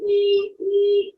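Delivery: high, loud mock alarm wail; alarm siren noise. Narrator mimics the alarm for listening children, high siren. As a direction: imitate a siren going off, high and piercing. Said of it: "the alarm again".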